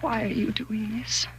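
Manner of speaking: slow, breathless whisper; terrified hushed plea; frightened whisper. Acted. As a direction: whisper it in fear. Frightened wife confronting husband.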